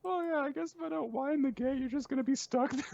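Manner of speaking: very high cartoon character voice; casual chatter in a squeaky put-on voice; Ninitsu. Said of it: "And Ninitsu says".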